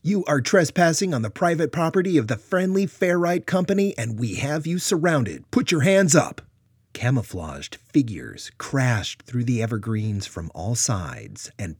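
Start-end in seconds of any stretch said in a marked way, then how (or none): 0.0-6.4 much higher, very loud command; authoritative bark. Acted. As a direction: bark it like a soldier. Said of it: "sharp, authoritative bark".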